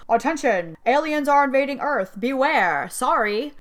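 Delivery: hammed-up emergency broadcast; mock alien alert announcement. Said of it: "they're like".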